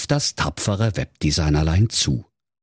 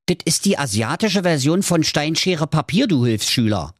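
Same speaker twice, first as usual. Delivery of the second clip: smart-alecky; 8 semitones higher than usual. Acted. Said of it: "neunmal klug".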